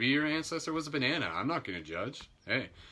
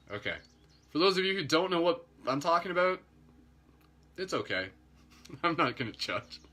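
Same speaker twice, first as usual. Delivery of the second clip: normal talking voice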